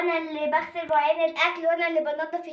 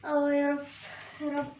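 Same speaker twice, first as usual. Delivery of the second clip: worn-out prayer; 3 semitones lower, 5 dB quieter than usual. Acted. Tired girl begging God after endless chores, faint and weary. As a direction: barely breathe it out, exhausted. Exhausted girl praying while sweeping.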